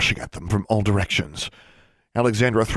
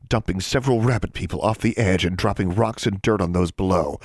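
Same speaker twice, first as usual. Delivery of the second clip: ordinary delivery, nothing marked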